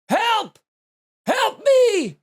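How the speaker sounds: high, loud "Help!" calls like a drowning man; desperate mock call for help; retold cry for help. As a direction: yell for help across the water. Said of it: "Like that".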